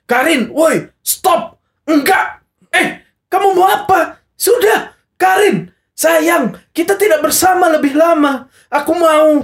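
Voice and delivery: hammed-up furious plea to Karin; angry dramatic reading. Friend reading the script aloud in a hammed-up angry voice. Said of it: "intonasinya lebih ke marah gitu kan".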